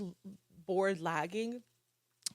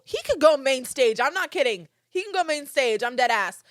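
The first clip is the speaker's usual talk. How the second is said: loud, higher, insistent gushing; emphatic hype; 4 semitones higher, 8 dB louder than usual. Real, not acted. Fan insisting the DJ deserves main stage, loud emphatic hype. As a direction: push each claim out with loud conviction.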